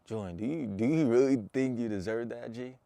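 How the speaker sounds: harsh inner voice. Retold or imitated. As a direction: grill yourself in your own head. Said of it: "thinking in my head".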